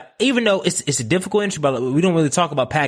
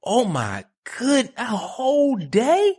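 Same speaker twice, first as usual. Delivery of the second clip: slow, high-pitched amazement; flabbergasted; 11 semitones higher, much slower than usual. Real, not acted. Host realising the flight takes a whole day, flabbergasted. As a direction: stretch it out in sheer disbelief.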